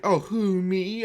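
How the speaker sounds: mock modest. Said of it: "so graciously introduce you saying".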